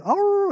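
very high, loud squealed oh; high playful oh. Retold or imitated. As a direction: squeal at the baby. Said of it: "me going".

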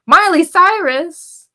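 slow, high, loud name call; announcer call. Retold or imitated. Hosts introducing a surprise guest, in a slow loud call. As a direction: boom the guest's name out like a stage host. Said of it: "They said".

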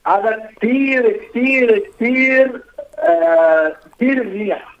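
high, drawn-out sarcastic "very good". Real, not acted. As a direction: say it like you mean the opposite. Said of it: "بس بطريقة ساركاستيك".

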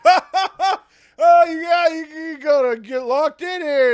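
loud, high jeering laugh-talk; high-pitched teasing coworker voice; mocking janitors. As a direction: taunt him through a hammed-up laugh.

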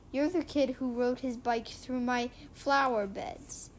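ordinary delivery, nothing marked

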